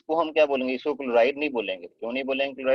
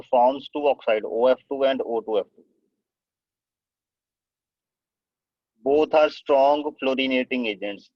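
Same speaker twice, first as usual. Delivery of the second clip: slow, deliberate dictation for students to write down; teacher spelling out notes word by word, slowly; 4 dB louder, much slower than usual. Shown as a demonstration. Teacher dictating notes to the class, slow and deliberate.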